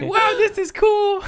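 put-on high, loud kid wonder; squeaky mock-child awe; little baby voice. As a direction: marvel like a tiny squeaky child. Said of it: "his little baby voice".

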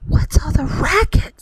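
high-pitched storybook cry; Cinderella's high cry; cried out. Acted. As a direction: call it out high, in protest. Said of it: "Cindy cried".

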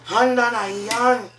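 slow drawn-out tongues; speaking in tongues. Real, not acted. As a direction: stretch out the tongues slowly. Tongues while praying alone.

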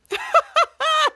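high, villain cackle; mock evil laugh of triumph; villain cackling over his puppets; evil giggle. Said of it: "Frank Keeney said".

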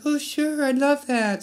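very high, delighted celebrity imitation. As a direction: squeak out a sweet eager yes. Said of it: "you killing me with that voice".

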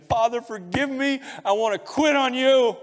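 high, loud, breaking cry to God; desperate plea. Retold or imitated. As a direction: plead with everything, pitched high and loud. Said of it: "I'm saying".

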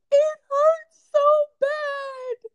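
high, loud, slow mock wail of heartbreak; imitated sobbing wail. Imitating her little sister sobbing on the bedroom floor, mock wail.

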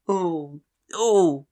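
put-on high, eager little-girl cries; a child holding out her arms; delighted child. A narrator's reading.

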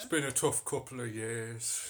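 low, put-on northern English voice; northern English accent. Blacksmith answering how business is, in a low northern English accent. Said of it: "Why are they all northern?".

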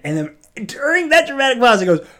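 can barely get it out for laughing. Real, not acted. Laughing while recalling the phone going off in class.

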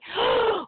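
sharp, high excited gasp. Real, not acted. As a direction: gasp with delight. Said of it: "Oh, it's gonna be exciting".

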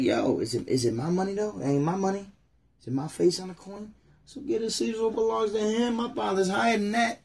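laid-back street-cool Jesus answering the crowd; smooth player Jesus. Acted. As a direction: play it smooth and unbothered.

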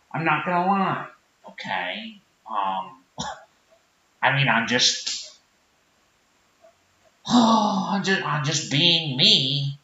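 low-pitched sheepish small-town guy; Rick character. Acted.

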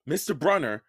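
ordinary delivery

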